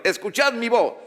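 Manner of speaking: high, emphatic proclamation; God's command. Acted.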